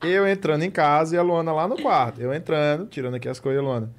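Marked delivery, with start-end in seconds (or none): none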